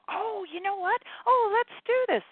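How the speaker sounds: high, bubbly voice of a playful spirit. Acted. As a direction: pitch it way up, eager and playful. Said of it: "have a playful voice".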